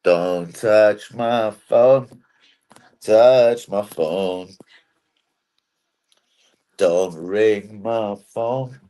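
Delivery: low, very slow, drawn-out words; put-on slow warning. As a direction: stretch each word low and slow.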